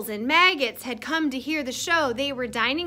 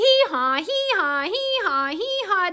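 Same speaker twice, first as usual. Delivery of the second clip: high, falling hee-haws; donkey bray; 5 semitones higher than usual. Pretend. Reader makes donkey sounds with the children, high braying. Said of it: "A donkey".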